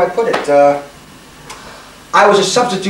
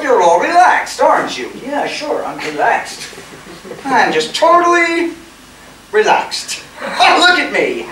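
sarcastic; 9 semitones higher than usual. Acted. Sarcastic mock relaxation rising to yell.

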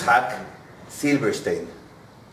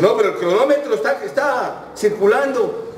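high, worried protest; anxious protest; 8 semitones higher, 3 dB louder than usual. Retold or imitated. Taxi driver frets about the running meter, anxious protest. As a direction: object urgently, pitch climbing, stretching the end.

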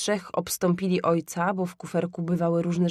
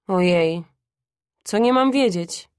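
put-on louder, lively boy; of-course-I-know exclamation; confident boyish reply; 5 dB louder than usual. A narrator's reading. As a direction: answer mother with breezy impatience. Boy answers mother confidently.

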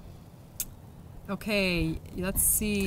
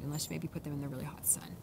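whisper; 5 semitones lower, 10 dB quieter than usual. Real, not acted. Aside in a whisper.

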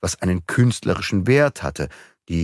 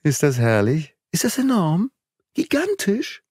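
rising, ever higher exclamations of awe; rapturous; 10 semitones higher than usual. Acted. Enthusiastic crescendo in a high voice. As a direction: Beautrelet marvels at the thief's coup, soaring upward. Raving about the thief's coup.

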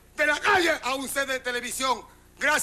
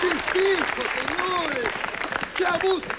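mock outrage; 4 semitones higher than usual. Comic shows the audience what is in the bag, mock outrage. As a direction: exclaim as if utterly scandalised.

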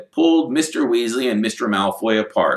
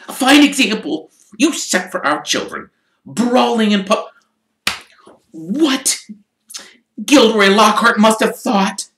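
higher, louder mother's rebuke; furious scolding; 13 semitones higher, 5 dB louder than usual. Acted. Wife scolding her husband for brawling, furious. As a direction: scold him all the way home. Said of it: "beside herself with fury".

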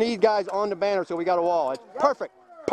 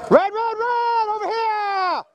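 urgent shout; 12 semitones higher, much slower than usual. Real, not acted. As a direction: yell a warning across the field. Alarm called mid-battle.

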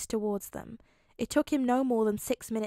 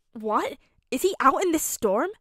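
shocked, higher and louder; alarmed; 4 semitones higher, 7 dB louder than usual. A narrator's reading. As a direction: burst out in alarm at the news. Tony hears Peter collapsed.

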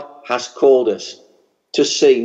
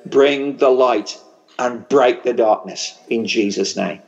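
ordinary talk, nothing marked

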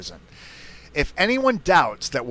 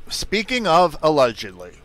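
hair in mouth; 4 semitones higher, 6 dB louder than usual. Real, not acted. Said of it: "I had a hair in my mouth".

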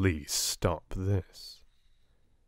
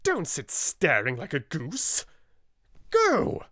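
fierce; 8 semitones higher than usual. A narrator's reading. Minchin orders fiercely.

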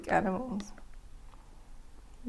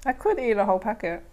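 biting snack mid-sentence; talking while chewing; faster than usual. Real, not acted. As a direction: mouth full of cracker. Seen 1: snack held at lips, biting and chewing during the words.

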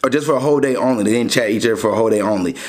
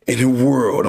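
movie trailer voice. Pretend. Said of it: "I'm playing".

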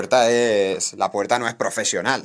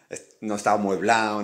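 usual speaking voice

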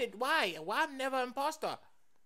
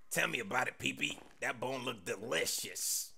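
low, soft creature voice; deep pet voice; 10 semitones lower, 4 dB quieter than usual. Acted. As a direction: rumble it like a big dog. Second pet answers.

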